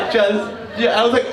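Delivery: ordinary talk, nothing marked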